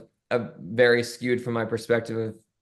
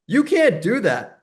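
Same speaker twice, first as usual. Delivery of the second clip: raised, higher "You can't do that."; retold stern warning; 10 semitones higher, 5 dB louder, slower than usual. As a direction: snap it like a guard stopping her. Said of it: "someone came over, like".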